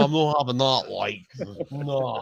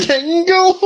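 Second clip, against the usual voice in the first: Pringle? squealed high and loud through a laugh; cracking up at the word; laughing disbelief; 10 dB louder than usual. Real, not acted.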